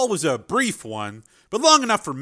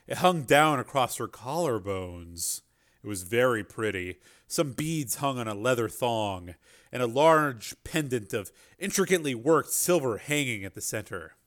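ordinary talk

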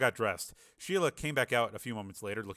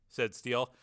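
usual speaking voice

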